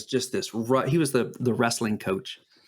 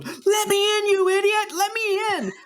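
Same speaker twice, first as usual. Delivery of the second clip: much higher, loud frantic yelling; panicked yell; 3 dB louder than usual. Retold or imitated. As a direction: pound on the door and yell to be let in. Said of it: "And he's going".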